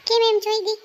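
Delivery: loud pushy question; sharp demanding question. Acted. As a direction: demand an answer right now. Friend presses her.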